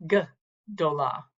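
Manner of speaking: "gdola" with a gap after the opening g; split into syllables. Shown as a demonstration. Teacher isolating the first syllable of "gdola", split apart. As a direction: set the "g" apart, then say the rest of "gdola". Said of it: "This would be pronounced altogether".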